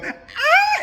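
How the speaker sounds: very high, loud hammed-up shriek; short piercing mock scream; mock horrified scream. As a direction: scream as if spirits overwhelm you, exaggerated. Acts out medium overwhelmed by spirits.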